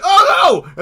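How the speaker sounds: loud, high cry of alarm; acted shriek of dismay; mock panicked yell. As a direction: yell it way up high like a startled creature. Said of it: "then starts yelling".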